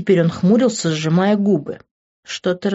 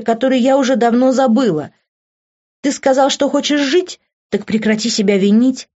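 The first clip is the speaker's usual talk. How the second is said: heroine raising her voice, somewhat louder; raised voice; 7 semitones higher, 5 dB louder than usual. A narrator's reading. Heroine to Grant, begging him to stop blaming himself, voice raised. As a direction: push back harder and louder, pleading. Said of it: "Уже сама повысила голос".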